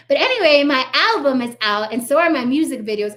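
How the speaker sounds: mocking a celebrity moving right on; flippant brush-off. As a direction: toss it off like nothing happened.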